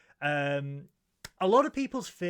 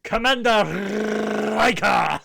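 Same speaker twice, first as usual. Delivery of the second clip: mock call; 4 semitones higher, 6 dB louder than usual. Mock-calling Riker laughing.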